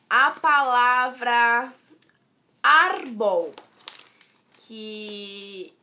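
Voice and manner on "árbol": "árbol" with a loud, strong ÁR, falling away after; stress on first syllable. Shown as a demonstration. Teacher showing a two-syllable example, "árbol" hit hard on ÁR. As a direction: punch the AR of "árbol". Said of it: "a mais forte é o ar".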